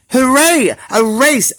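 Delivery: cheering. Acted. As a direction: cheer for the race. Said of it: "everyone cheered".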